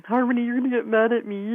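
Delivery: high, loud anxious client voice; worried client. Retold or imitated. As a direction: play the nervous client bracing for a scolding. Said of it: "a handful will say".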